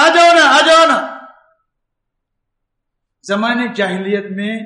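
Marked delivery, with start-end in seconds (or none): 0.0-1.2 high, loud beckoning call to come over; a woman calling her old lover to come; coaxing call. Retold or imitated. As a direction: call him over sweetly but loudly. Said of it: "کہا".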